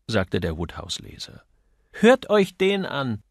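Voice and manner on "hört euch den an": high, loud, drawn-out indignation; indignant. A narrator's reading. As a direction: exclaim it in outrage to the others. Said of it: "rief Miss Parton entrüstet". (entrüstet gerufen)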